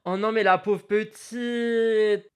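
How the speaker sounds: slow, gasp then mock pity. Real, not acted.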